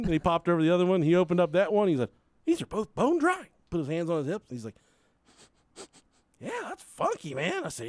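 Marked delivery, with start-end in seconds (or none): 2.4-3.4 high, puzzled clerk voice. Retold or imitated. Clerk puzzled by the empty tanks, pitched high. 6.4-7.6 high, soft mock clerk; lightly voiced baffled clerk; puzzled clerk. Clerk with hands on hips, high and puzzled.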